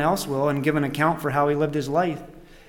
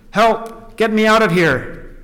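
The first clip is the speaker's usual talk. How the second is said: high, raised cry for rescue; acted storybook yell; yelled plea; 6 semitones higher, 3 dB louder, slower than usual. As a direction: call out urgently from inside. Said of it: "I yelled".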